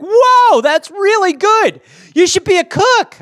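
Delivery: be wowed by the dish; amazed gushing. Acted. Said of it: "I'd be like".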